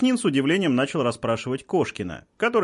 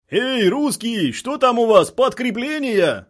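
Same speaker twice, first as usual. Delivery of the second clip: high, drawn-out taunting call; hint of a guttural militant calling over; lightly voiced guttural shout; 9 semitones higher, 4 dB louder, slower than usual. A narrator's reading. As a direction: call it out slowly across the lines, raised pitch. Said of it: "раздался гортанный голос".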